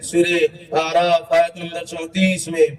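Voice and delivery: usual speaking voice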